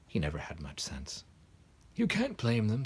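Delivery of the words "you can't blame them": plain reading voice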